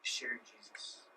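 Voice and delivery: hushed whisper on the key words. Acted. Preacher whispering to the congregation. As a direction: drop to a whisper for weight.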